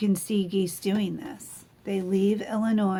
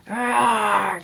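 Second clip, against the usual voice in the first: loud, gruff bear roar; grumpy bear roaring at goslings; 2 semitones higher, 5 dB louder than usual. Acted. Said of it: "he became extra grumpy with them".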